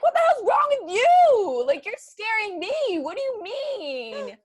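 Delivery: very high, loud comeback, last words stretched out; mock indignant retort. Daughter retorts to the dad who scared her, mock indignant yelling. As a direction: throw the question back, hammed-up and shrieky.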